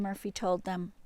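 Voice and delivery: ordinary delivery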